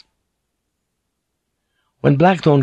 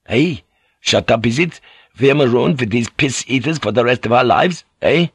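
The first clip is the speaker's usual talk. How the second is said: put-on agitated sailor with a foreign accent; acted indignant challenge, jumping in pitch; Fink; 2 semitones higher than usual. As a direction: throw it back at him, accented and aggrieved. Fink angrily confronts the pilot.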